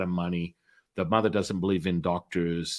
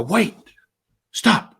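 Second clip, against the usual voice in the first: sudden loud high call to stop her; urgent call; 10 semitones higher, 7 dB louder than usual. Retold or imitated. Calling out so his friend won't hang up, loud and urgent. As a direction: cut her off before she hangs up.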